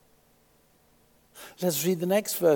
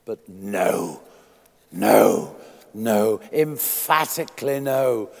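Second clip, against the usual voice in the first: low, firm repeated no; insistent preacher's denial; emphatic no; 3 semitones lower, 7 dB louder, slower than usual. Acted. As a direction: drive each no home.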